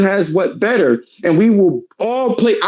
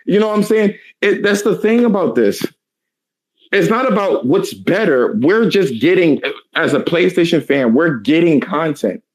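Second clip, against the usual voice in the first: normal talking voice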